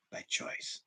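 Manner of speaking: voiceless, barely audible; whispered refrain. Real, not acted. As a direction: breathe the words to let them land.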